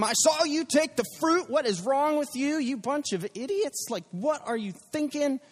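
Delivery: high, hammed-up scolding rant; mock angry shout. God imagined shouting at Adam and Eve, mock angry. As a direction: berate them like an exasperated parent. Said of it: "He doesn't come shouting at them".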